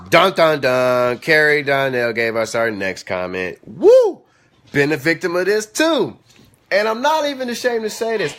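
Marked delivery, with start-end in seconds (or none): 0.1-1.2 loud sung-out "dun, dun, dun"; dramatic suspense sting. Acted. Mock suspense sting after viewer question. 3.7-4.2 loud, high-pitched "woo"; high whoop. Real, not acted. Reacts to the next comment.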